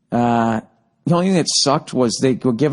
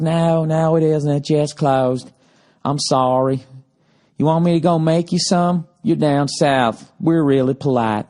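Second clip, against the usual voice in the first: mock Southern hotel receptionist; exaggerated Southern hospitality voice; Southern accent; 2 semitones higher than usual. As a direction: put on a polite, drawn-out Southern accent. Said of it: "I just like the Southern accent".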